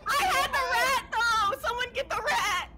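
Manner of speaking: high, loud frantic shout. Real, not acted. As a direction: yell for help. Dropped the rat, shouting.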